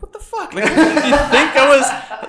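very high, laughing disbelief; mock shocked reaction. His own shocked reaction.

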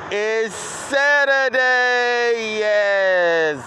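high, loud, long-drawn shout; hyped holler. Real, not acted. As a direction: holler it out with every vowel stretched. Hyped announcement of the day.

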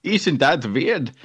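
slow, hammed-up foreign accent; Goldmember-like accent. Echoing the co-host's guess at the visitor, Goldmember-like foreign accent. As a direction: draw it out in a mock foreign accent. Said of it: "Gold member?".

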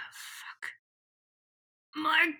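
unvoiced hoarse curse; a hint of a ruined throat; rough-throated. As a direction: rasp it out as if her throat were wrecked. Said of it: "Her throat's fucked up".

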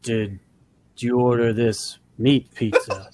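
"meat" leaned on hard in "Did you order this meat pizza?", whole line slow; slow, stressed meat. Shown as a demonstration. Pizza guy at the door, slow, leaning on meat. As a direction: drag it out and hit meat. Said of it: "what about the meat?".

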